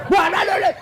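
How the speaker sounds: high-pitched burst of angry jabber; mock angry husband. Husband keeps scolding over wife's chant, mock angry jabber. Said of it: "Marah suami tak".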